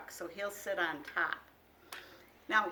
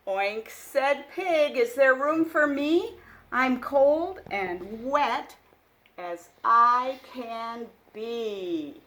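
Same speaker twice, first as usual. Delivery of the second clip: put-on louder, lively read-aloud for small children; picture book reading; 2 semitones higher, 10 dB louder, slower than usual. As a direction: read it like a bedtime storybook. Reads animal dialogue.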